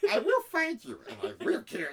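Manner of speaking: high, loud comic threat with laughter; laughing high-pitched little tough guy; midget Liam Neeson. Acted. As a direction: squeak out the threat as a tiny action hero. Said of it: "Midget Liam Neeson".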